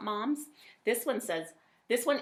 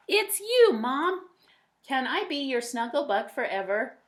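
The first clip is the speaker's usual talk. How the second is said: lively, louder storybook voice; reading to children; 2 semitones higher, 4 dB louder than usual. A narrator's reading. A parent reading a picture book aloud to kids, lively. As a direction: read it brightly to young listeners. Reads child narrator Max.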